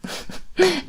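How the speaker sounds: quiet breathy laugh; light closing chuckle; soft laugh. Real, not acted. Teacher laughs softly at the end. Ending lesson.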